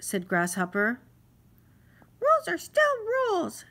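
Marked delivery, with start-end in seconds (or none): 2.2-3.6 high, louder insistent insect shout; mosquito shouting. Acted. Mosquito shouts his rule at Grasshopper, high and loud. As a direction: bellow the rule at him, tiny but stubborn. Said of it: "shouted the mosquito".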